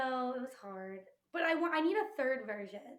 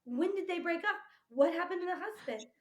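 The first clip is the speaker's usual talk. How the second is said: re-enacted bafflement, pitched up; retold disbelief; 6 semitones higher than usual. Viewer replaying the breakup twist, retold disbelief. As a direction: play up the shocked confusion, higher as it goes.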